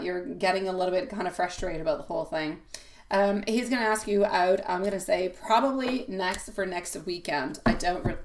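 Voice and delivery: ordinary talk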